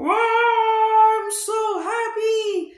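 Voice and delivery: high, sung wow of a freed little dino; Mister Dino celebrates getting out of the mud; happy dinosaur cheer. Acted.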